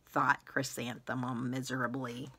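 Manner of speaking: plain reading voice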